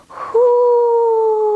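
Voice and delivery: high, sustained hoo; owl hoot. Pretend. Reader turning head as an owl, long high hoot. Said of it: "we're going to say".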